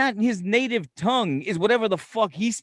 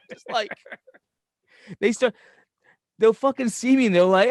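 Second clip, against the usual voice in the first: usual speaking voice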